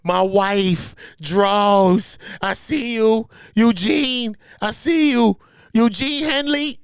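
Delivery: high, loud drawn-out yelling; retold screaming. Recounting Big U's story of spotting them, imitated screaming. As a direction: scream it out like calling across the street. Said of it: "this end screaming".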